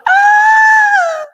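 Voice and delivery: high piercing imitated scream, loud; taunting the rival with a loud, high mock scream. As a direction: shriek like a beaten man, mocking.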